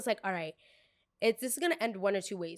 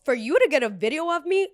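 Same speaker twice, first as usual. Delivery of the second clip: raised, higher voice in indignant protest; indignant confrontation; 6 semitones higher, 8 dB louder than usual. Retold or imitated. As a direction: push it up loud, challenging the person filming. Confronting the friend filming her.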